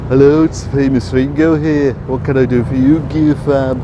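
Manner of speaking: a cheeky, hammed-up phone greeting. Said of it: "he decides he's going to impersonate [name]".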